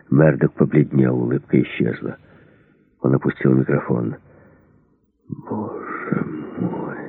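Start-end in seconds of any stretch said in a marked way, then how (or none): 5.3-7.0 very slow, faint, frightened whisper; trembling voice. A narrator's reading. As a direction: breathe it out in dread. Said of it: "произнёс он дрожащим голосом". (дрожащим голосом, еле слышно)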